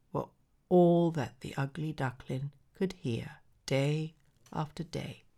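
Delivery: plain reading voice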